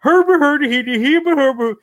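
high, loud Muppet-chef babble; Swedish Chef gibberish. Retold or imitated. Mocking the bad year as the Swedish Chef, high and loud. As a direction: babble like a goofy puppet chef. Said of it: "like the Swedish chef's evil Muppet brother".

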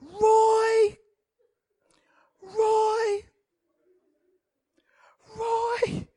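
high panicked call fading to a whisper; frightened calling. Retold or imitated. Wife calling husband about a spider, scared.

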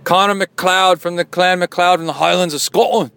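loud, proud mock Highlander Scottish; Scottish accent. Host playing a Highland clansman, loud Scottish accent. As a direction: declare it in a high, hammed-up Scots brogue. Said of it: "Should I read it with my Scottish accent?".

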